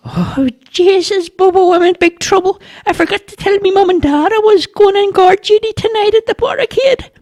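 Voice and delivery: very high, squeaky alarm; panicked little boy. A narrator's reading. Boy realising he forgot to tell his parents, panicked. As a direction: wail it out in a high child voice.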